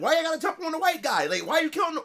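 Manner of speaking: high, quick mock protest; playful trash talk. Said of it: "we just talking trash".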